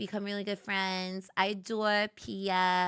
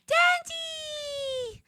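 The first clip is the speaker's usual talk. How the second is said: high, gasped cry of a name; delighted gasp. Real, not acted. Streamer spotting Dandy in chat, delighted gasp. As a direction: gasp with joy at seeing him. Greeting arriving chatter.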